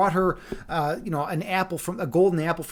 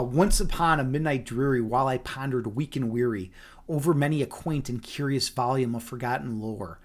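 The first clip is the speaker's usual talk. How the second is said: poem recitation; 6 semitones lower than usual. Shown as a demonstration. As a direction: recite Poe from memory in a hushed cadence. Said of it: "Edgar Allan Poe's The Raven memorized".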